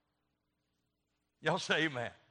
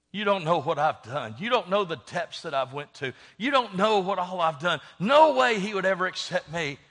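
loud, insistent protest building in force; acted anguished protest; 6 dB louder than usual. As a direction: protest harder with each phrase, getting louder. Iris protests she is unworthy.

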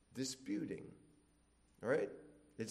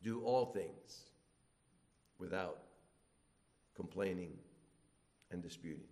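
solemn, fading; 8 semitones lower, 6 dB quieter than usual. Shown as a demonstration. Preacher reading the verse to his church, slowing and fading. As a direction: let the verse sink in quietly. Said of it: "look at the scope".

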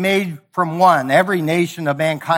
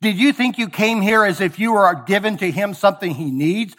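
ordinary talk, nothing marked

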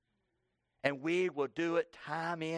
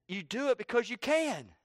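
raised pitch, falling hard on CAN; emphatic declaration; 7 semitones higher than usual. Real, not acted. Preacher explaining presumptuous sin to congregation, emphatic. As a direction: drive home "you do it because you can" in a higher voice. Says line forcefully, then repeats it softer.

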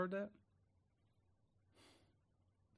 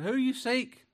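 much higher, louder ringing question; preached question; 11 semitones higher, 7 dB louder, slower than usual. Acted. Jesus challenging the soldiers who came for him, high and ringing.

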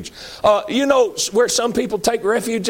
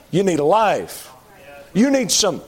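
usual speaking voice

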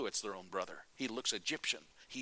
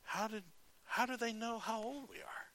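much higher, softer baffled wonder; hushed amazement; 10 semitones higher, 5 dB quieter than usual. Acted. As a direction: brothers whispering in disbelief. Brothers wonder at seating order.

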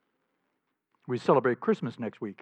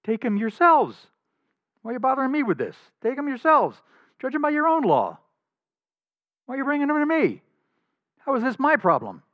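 higher, louder, fed-up brush-off; exasperated Pilate; 12 semitones higher, 5 dB louder than usual. Retold or imitated. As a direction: throw it back at them, annoyed. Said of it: "Pilate said to them".

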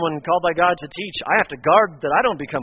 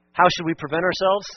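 ordinary delivery

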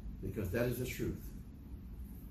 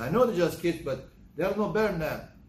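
retold irritation; 5 semitones higher, 5 dB louder than usual. Retold argument with wife.

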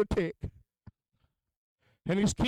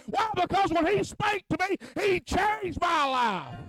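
full-force preaching; 9 semitones higher than usual. Acted. Preacher testifying to the congregation, building to a shout. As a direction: preach it harder with every line.

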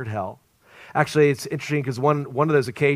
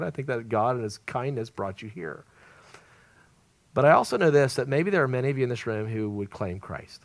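ordinary delivery

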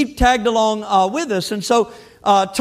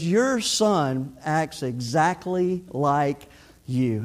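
low, slow and soft, drawn out; deadpan punchline; 7 semitones lower, 7 dB quieter, much slower than usual. Retold or imitated. Friend telling golfer about his son, slow deadpan. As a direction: land it slowly and quietly, word by word.

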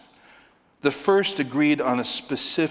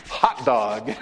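imitating delight. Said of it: "and they're like".

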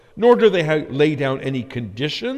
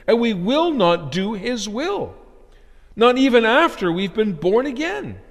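higher-pitched, emphatic sermon delivery; forceful preaching; 7 semitones higher, 5 dB louder than usual. Real, not acted. Preacher telling the congregation they are rebels by nature, forceful. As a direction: preach it insistently, pitch lifted high.

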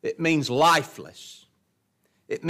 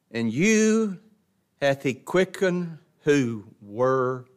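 slow scripture reading; slower than usual. Acted.

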